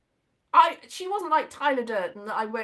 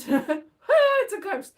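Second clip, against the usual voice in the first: slow, laughing aside, a little higher and louder; 8 semitones higher, 6 dB louder, much slower than usual. Real, not acted. Reacting to a slammed door while telling the story, laughing. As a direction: laugh it off as a joke.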